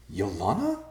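puzzled question, rising at the end; confused, disbelieving, lifting upward. A narrator's reading. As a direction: say the name as a bewildered question. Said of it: "Ben said in confusion".

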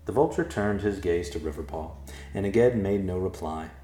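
plain reading voice

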